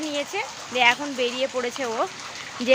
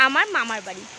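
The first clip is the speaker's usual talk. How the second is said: usual speaking voice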